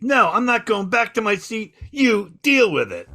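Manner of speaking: high-pitched mock defiance; defiant refusal. Volunteer won't go back to his seat, defiant refusal. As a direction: imitate someone indignantly standing their ground.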